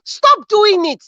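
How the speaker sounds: preacher's slow insistent order; emphatic plea. Real, not acted. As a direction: hammer home the command slowly. Preaching plea to rice sellers.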